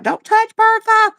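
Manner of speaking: high, loud mock yell backstage; mock screaming. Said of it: "why y'all are screaming".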